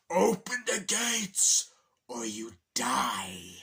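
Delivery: slow, loud villain threat, every word drawn out; menacing Bowser. Retold or imitated. Bowser demanding the gates open, slow loud menace. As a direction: growl it like a monster king at the gates.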